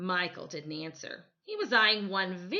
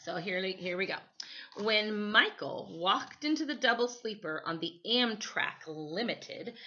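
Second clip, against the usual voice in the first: ordinary delivery, nothing marked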